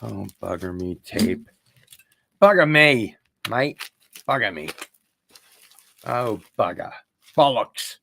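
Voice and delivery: a light, hammed-up Australian accent; mock Aussie cursing, only lightly put on; horrible Australian accent. Fumbling with tape while cursing in a bad Australian accent. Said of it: "doing a horrible Australian accent".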